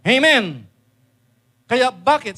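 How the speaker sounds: loud call to the crowd; shouted church call. Real, not acted. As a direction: call it out to the hall. Preacher calling out to congregation.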